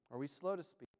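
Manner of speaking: low, soft searching questions with long pauses; hushed preaching. Real, not acted. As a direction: drop low and quiet, let each question hang.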